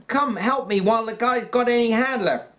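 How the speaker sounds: high urgent shout. A narrator's reading. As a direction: shout it over and over. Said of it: "George shouted over and over".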